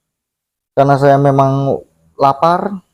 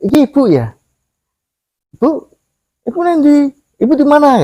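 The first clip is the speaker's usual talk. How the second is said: much higher, searching call for mother; confused calling; 12 semitones higher than usual. Retold or imitated. Lost in the spirit realm, asking for his mother, high and bewildered. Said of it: "saya bilang".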